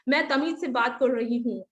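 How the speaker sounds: ordinary talk, nothing marked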